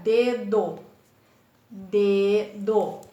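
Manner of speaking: DE - DO, drawn out in two beats; teacher sounding out DE-DO; split into syllables. Shown as a demonstration. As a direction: say dedo as two separate pieces, DE, DO. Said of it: "Duas sílabas".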